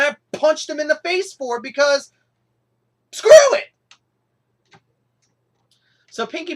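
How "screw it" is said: sudden much higher and louder blurt; cartoonish shriek of screw it; mock yell. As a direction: scream it like a hothead. Mock punching someone over ice cream.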